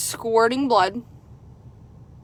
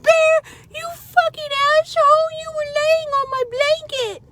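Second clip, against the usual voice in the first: retold scream. Yelling at the dog Bear for lying on her blanket, furious scream. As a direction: scream at the dog in higher pitch. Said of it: "And I screamed".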